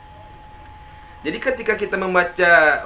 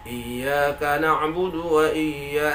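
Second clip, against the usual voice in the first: slow level chant, "Iyyaka" unstressed; no lift on "iyya-"; flat recitation; much slower than usual. Shown as a demonstration. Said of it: "Jangan datar". ("Iyyaka" datar tanpa tekanan)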